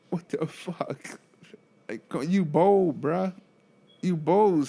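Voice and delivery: drawn out disbelief; slow, stunned, half-amused; slow incredulous. Real, not acted. Shocked reaction to patient.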